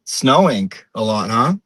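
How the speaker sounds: put-on old-school Portuguese-American accent, hard word endings; everyday small talk in a light Portuguese-American accent; imitated Portuguese-American neighbourhood woman. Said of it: "if you're Portuguese, it's very close".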